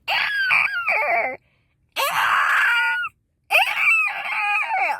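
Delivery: very high imitated toddler shriek; piercing mock child squeal; kid screeching. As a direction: shriek like a noisy little kid. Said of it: "her kid going".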